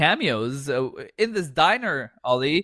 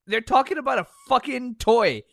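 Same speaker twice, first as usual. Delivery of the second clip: ordinary delivery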